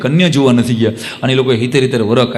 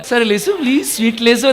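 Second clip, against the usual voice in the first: put-on sweet catering girl; 7 semitones higher than usual.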